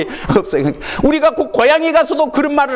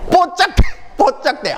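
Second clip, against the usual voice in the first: Jeolla dialect; 8 semitones higher than usual. Retold or imitated. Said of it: "그대로 그 말을 쓰시오".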